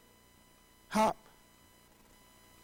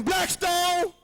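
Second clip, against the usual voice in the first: shouting; 9 semitones higher than usual. Acted. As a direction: yell the gang name out. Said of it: "shooting and shouting".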